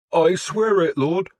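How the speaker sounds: high, loud desperate plea; begging in desperation. A narrator's reading. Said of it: "the reeve said in desperation".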